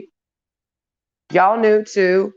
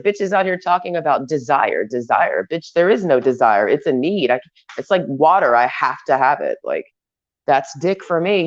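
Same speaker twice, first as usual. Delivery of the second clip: ordinary talk, nothing marked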